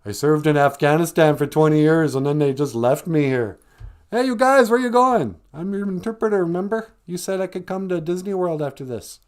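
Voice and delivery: a desperate abandoned man calling after departing troops; mock desperate cry after leaving soldiers; stranded interpreter. Acted stranded interpreter quote.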